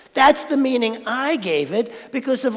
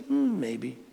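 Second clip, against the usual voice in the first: lower, softer, unbothered farmer; the farmer's even reply; calm farmer; 8 semitones lower, 8 dB quieter than usual. Acted. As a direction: shrug it off like a calm old farmer. Farmer voiced in Zen story.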